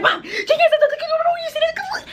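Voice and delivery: high, laughing rush of excitement; excited, laughing. Retold or imitated. Daughter showing mom the Genius post, excited and laughing. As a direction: squeal it out between laughs.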